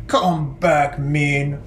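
slow mock-gruff call; mock mean. Shown as a demonstration. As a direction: play the mean bird for kids. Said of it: "mean bird voiced".

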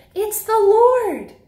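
high, slow, awed exclamation; amazed recognition. Acted. Disciple tells Peter he recognizes Jesus, slow and awed. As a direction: let the wonder land on Lord.

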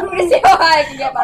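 fast squeaky laughing; helium laughter. Real, not acted.

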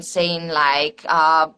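ordinary delivery

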